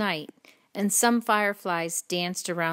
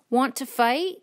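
grouchy challenge; 7 semitones higher, 6 dB louder than usual. A narrator's reading. Grouchy ladybug picking a fight, loud rising challenge. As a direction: dare them, voice raised and climbing.

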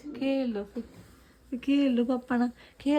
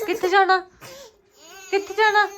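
laughing; 6 semitones higher, 4 dB louder than usual. Real, not acted. Laughing at baby's wish.

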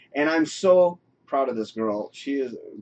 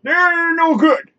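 mock high voice; 10 dB louder, much slower than usual. Put-on high slow voice.

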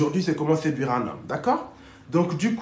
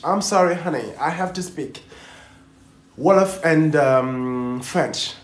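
French-accented English; halting English with a French accent, searching for words; slower than usual. Real, not acted. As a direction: speak English in a francophone accent, with hesitations. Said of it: "j'aime bien ton accent".

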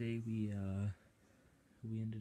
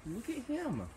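cooing at dog; 9 semitones higher than usual. Real, not acted.

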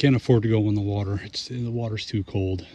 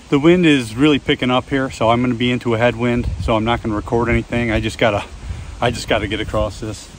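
louder, pushing the voice over the wind; raised over wind; 7 dB louder than usual. Real, not acted. Canoeist on a windy pond talking over the gusts, raised voice. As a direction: call it out over a strong headwind.

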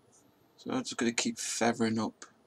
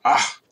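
sudden loud cry; 14 dB louder than usual. Real, not acted. Crashes into the wall.

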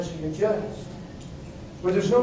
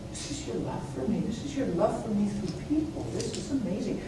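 soft prayer; 3 semitones higher, 8 dB quieter than usual. Retold or imitated. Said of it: "and I said".

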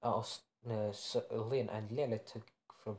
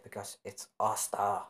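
ordinary delivery

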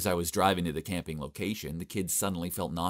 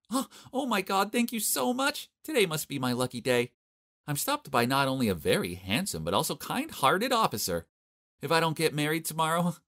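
gushing flirt; 8 semitones higher than usual. A narrator's reading. Mila flirts with the officer.

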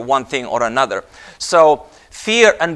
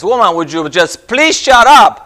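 higher, louder acted exasperation; exasperated; 6 semitones higher, 8 dB louder than usual. Exasperated man to his wife at the traffic stop, acted exasperation. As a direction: build to a fed-up outburst. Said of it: "the man is really exasperated".

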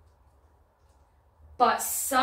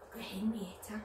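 secret whisper; 9 dB quieter than usual. Acted. Revealing a puppet to children.

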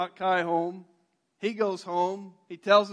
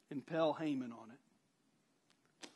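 cold verdict; 5 semitones lower, 9 dB quieter, slower than usual. Retold or imitated.

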